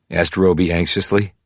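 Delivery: ordinary delivery, nothing marked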